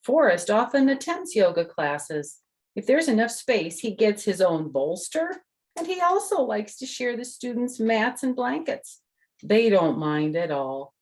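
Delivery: bright storybook reading voice; reading to children. Acted. The author reads her book to the kids, bright storytelling. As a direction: read it out to little listeners.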